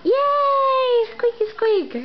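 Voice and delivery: delighted parent cheer; excited cheering. Real, not acted. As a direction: whoop for her toy. Cheering baby's toy play.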